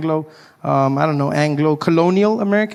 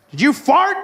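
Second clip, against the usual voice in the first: America accusing; 6 semitones higher than usual. Pretend. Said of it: "America's like".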